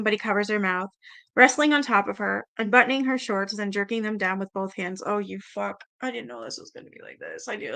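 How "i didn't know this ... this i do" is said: quiet, appalled aside under the breath; muttered disgust. Real, not acted. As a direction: mutter it to yourself, disturbed. Reader shocked by the passage.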